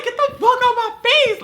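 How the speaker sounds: high-pitched exasperated shout; frustrated yell. Retold or imitated. Fed up with roommate in her face, high angry yell.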